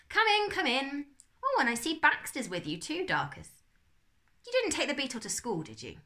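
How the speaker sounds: a genial uncle ushering them in; Uncle Max voice. Acted. Uncle Max greets the children.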